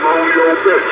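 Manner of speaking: squeaky high-pitched taunt; high mocking taunt. Real, not acted. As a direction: jeer it up high. Mocking insult.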